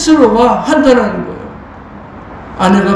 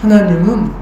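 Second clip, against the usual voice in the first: normal talking voice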